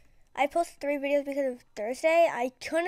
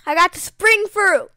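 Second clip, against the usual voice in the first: louder, higher excited shout; ecstatic shout; 6 semitones higher, 14 dB louder than usual. Real, not acted. Gamer repeating the news to viewers, a louder, higher shout. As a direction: shout it out in delight.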